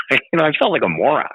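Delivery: ordinary delivery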